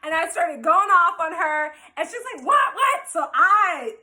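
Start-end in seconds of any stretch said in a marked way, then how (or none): 2.4-3.1 high startled repeat; shocked. Retold or imitated. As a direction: blurt it out, stunned. Other woman shocked.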